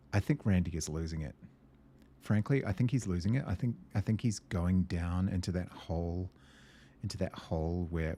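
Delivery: ordinary talk, nothing marked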